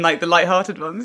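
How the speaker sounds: ordinary talk, nothing marked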